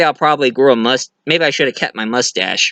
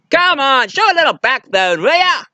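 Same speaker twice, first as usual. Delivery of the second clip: high, mock-scolding nudge; teasing pilot; 10 semitones higher than usual. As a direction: tease him to toughen up. Acting Jock teasing Indy.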